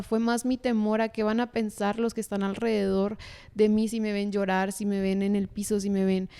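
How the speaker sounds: ordinary delivery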